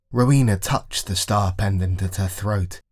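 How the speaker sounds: ordinary delivery, nothing marked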